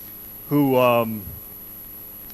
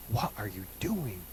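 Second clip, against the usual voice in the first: softer, a small lost voice asking; hushed doubting question; 5 semitones lower, 13 dB quieter than usual. Retold or imitated. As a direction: murmur it like a worried prayer. Voicing a doubting believer's question.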